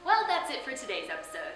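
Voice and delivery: ordinary talk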